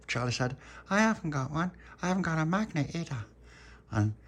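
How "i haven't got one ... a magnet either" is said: put-on high-pitched child character; Charlotte, left out. A narrator's reading. Charlotte admitting she has no magnet, high little voice. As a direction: pipe up high, owning up.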